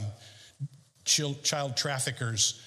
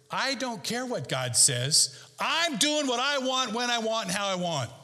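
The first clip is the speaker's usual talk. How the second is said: defiant sinner; 7 semitones higher than usual. Retold or imitated.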